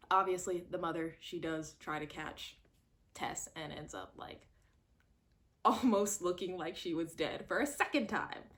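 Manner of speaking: normal talking voice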